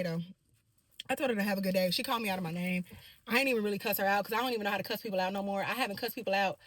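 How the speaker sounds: usual speaking voice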